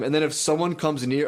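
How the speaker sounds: ordinary talk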